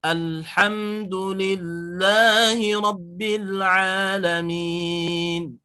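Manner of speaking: slow chanted recitation, the lam held long; slow Quranic recitation with a held lam. Shown as a demonstration. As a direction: chant it slowly, holding the lam in alhamdulillahi. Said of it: "Jadi harus ditahan si lamnya".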